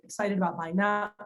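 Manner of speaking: ordinary talk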